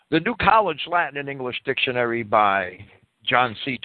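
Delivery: ordinary talk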